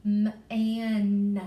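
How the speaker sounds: M split off and stretched, then -an; segmented M-an. Shown as a demonstration. Teacher to class, sounding out the word slowly. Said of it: "I hear that N sound at the end".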